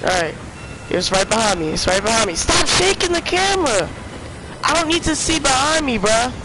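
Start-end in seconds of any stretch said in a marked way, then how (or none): 2.3-6.3 high-pitched, frantic protest; exasperated complaint. Real, not acted. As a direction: plead with the game, voice pushed way up. Panicking at game camera while chased.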